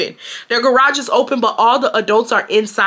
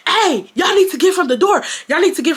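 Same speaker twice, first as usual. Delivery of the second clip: higher-pitched imitation of an angry order; retold annoyed command, pitched up; mad brother; 7 semitones higher than usual. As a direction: imitate a brother warning people off. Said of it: "he gets mad".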